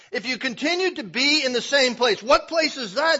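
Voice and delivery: loud, high, driving sermon climax; full-force preaching. Acted. Preacher pressing the church, loud and rising.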